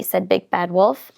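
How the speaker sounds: ordinary delivery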